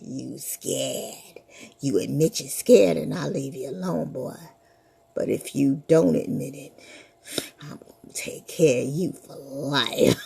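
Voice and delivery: low menacing ghoul; low whispered menace; monster threat. Acted.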